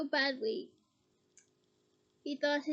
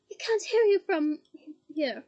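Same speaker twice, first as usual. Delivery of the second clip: shouting; 9 dB louder than usual. Acted. Harry shouting at Bellatrix. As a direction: hurl it at her through pain.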